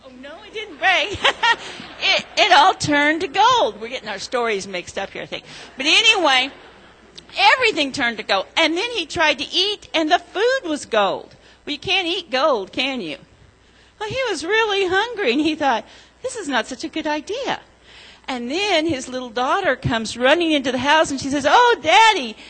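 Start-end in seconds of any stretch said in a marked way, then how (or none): 0.1-3.8 put-on storytelling to children. A narrator's reading. Children's story told in church. 5.8-21.5 put-on storytelling to children. A narrator's reading. Children's story told in church. 21.5-22.4 put-on high, bright little-girl call, louder; little girl calling Daddy. Little daughter voiced in story.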